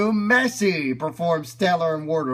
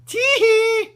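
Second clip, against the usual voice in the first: high-pitched tee hee giggle; 4 dB louder than usual. Retold or imitated. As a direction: giggle like a little girl. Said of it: "from that video".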